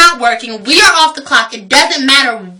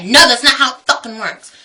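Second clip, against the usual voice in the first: normal talking voice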